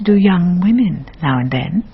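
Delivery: whispered, knowing aside to the listener; wry whispered aside. Acted. Narrator confides to the audience in a wry whisper. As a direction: let it slip out as a sly whisper.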